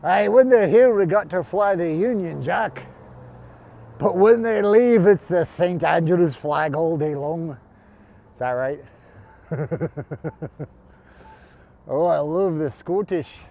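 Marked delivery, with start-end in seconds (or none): 0.0-7.6 loud, hammed-up Scottish accent, slow at the end. Scottish accent bit after Scotland joke. 11.9-13.4 put-on Scottish accent. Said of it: "You got to sound like your fat bastard".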